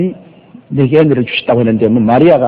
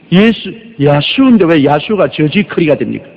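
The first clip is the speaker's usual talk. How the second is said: normal talking voice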